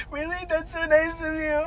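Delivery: high weepy squeal; mock tearful gratitude. Sobbing driver let off with warning, mock crying. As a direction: gush thanks through pretend tears.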